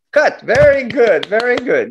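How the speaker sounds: calling cut. Retold or imitated. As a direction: call cut across the set. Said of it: "the director comes".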